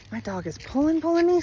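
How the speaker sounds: breathless while walking the dog; puffing a little between words; out of breath. Real, not acted. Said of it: "Sorry, I'm getting out of breath".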